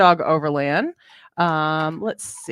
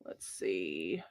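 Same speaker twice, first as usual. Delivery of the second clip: whispered aside to herself; 13 semitones lower, 20 dB quieter than usual. Real, not acted. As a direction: breathe it out softly. Whispered aside while reading chat.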